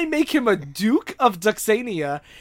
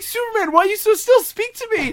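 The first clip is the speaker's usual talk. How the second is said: high-pitched sidekick begging in alarm; mock distraught pleading; 11 semitones higher, 3 dB louder than usual. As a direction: act out a hammed-up desperate plea. Jimmy pleads with motionless Superman.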